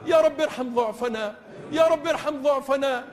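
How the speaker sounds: ordinary delivery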